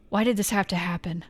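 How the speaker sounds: normal talking voice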